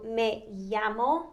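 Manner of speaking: "me llamo" slowly as meh YAH-mo; ya sound. Shown as a demonstration. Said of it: "ya sound".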